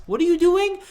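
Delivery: high, loud incredulous question; baffled disbelief. Retold or imitated. Student lost in company jargon, high loud retold bafflement. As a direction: jump up in pitch, totally lost.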